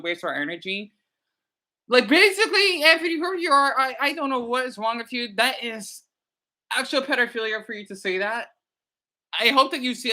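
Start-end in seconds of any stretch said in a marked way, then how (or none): 1.9-8.2 confronting. Real, not acted. Addressing the troll directly.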